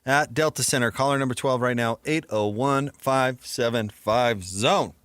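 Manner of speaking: loud, high, punchy announcer read; radio contest promo. Acted. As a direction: pump up the ticket giveaway. Live on-air contest giveaway read.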